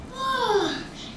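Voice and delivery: a long high sigh falling away as the cat stretches awake; sleepy cat's yawning sigh; waking sigh. Acted. Cat wakes from long nap.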